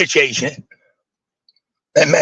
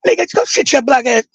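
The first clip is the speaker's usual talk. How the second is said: friend scolding; 10 semitones higher than usual. Retold or imitated. Imitating friend scolding kids.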